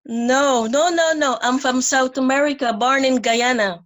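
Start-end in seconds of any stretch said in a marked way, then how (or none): none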